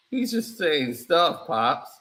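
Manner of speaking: low, sulky answer to his father; a drowsy low mumble; deep son voice. Acted.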